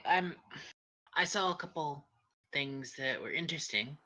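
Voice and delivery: ordinary talk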